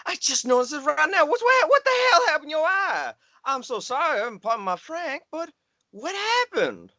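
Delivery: put-on Southern accent. Job interviewer hears a Southern caller, thick Southern accent. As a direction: play up a down-south twang. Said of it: "You sound like you're from the South".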